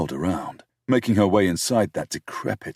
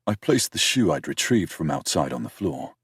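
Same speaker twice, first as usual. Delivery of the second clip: plain reading voice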